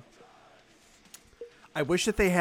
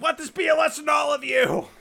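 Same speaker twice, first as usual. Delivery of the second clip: loud high proclamation; mock proclamation; 12 semitones higher, 7 dB louder than usual. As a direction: proclaim it to a crowd. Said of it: "I was like".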